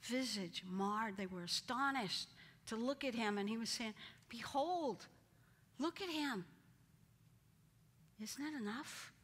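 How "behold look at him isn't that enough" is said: pleading Pilate. Acted. Pilate pleads, retold again.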